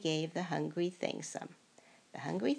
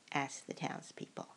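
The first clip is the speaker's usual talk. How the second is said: ordinary delivery, nothing marked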